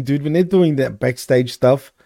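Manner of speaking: normal talking voice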